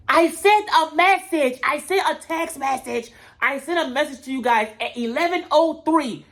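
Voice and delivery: high, slow whiny excuse voice; mocking Natalie. Mimicking Natalie defending herself to the girls, high and whiny. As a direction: whine it high and drawn out like a lame excuse.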